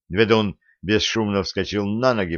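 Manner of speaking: ordinary delivery, nothing marked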